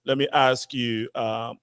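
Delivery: normal talking voice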